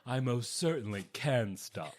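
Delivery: lightly voiced Al, laughing; a hint of Al's smug denial; imitating Al. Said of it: "That is so what Al would say".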